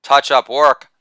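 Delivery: ordinary delivery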